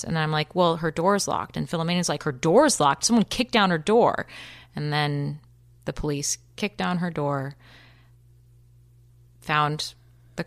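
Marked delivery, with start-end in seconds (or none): 2.2-4.3 louder, incredulous echo turning into an urgent demand; urgent retold outburst; alarmed disbelief. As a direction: react with alarm, pushing the voice up and louder. Retells roommate's alarmed reaction to news.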